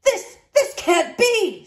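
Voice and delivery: high, slow loud panicked shout. A narrator's reading. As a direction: shout in flustered disbelief. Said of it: "shouted Horace".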